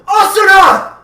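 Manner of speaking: full-force high yell; very loud alarmed cry; desperate shout. A narrator's reading. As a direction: scream the name after someone falling. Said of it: "he shouted".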